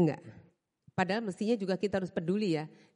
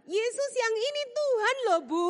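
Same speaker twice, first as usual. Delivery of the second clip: higher, mock-stern reminder; a teasing impression of the man correcting her; scolding churchgoer; 12 semitones higher, 4 dB louder than usual. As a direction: pitch it up like a pointed nudge. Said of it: "kira-kira gitu".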